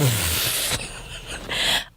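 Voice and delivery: soft, low, breathy chuckle; low breathy laugh. Real, not acted. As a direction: exhale a quiet laugh. Laugh after admitting depression.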